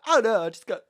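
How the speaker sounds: high, flustered mock wail. Imitating her friend with the crooked curtains, flustered wail. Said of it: "And you're like".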